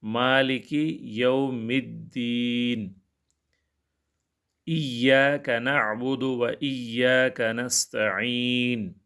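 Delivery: very slow, drawn-out melodic recitation; Quran recitation. Acted. Teacher recites the Fatiha for his students, slow Quranic chant. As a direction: chant each word slowly like in prayer.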